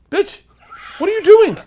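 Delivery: high, loud, outraged disbelief; alarmed yell. Retold or imitated. Husband to wife who hit the horn, alarmed yell. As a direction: explode at your partner in panic.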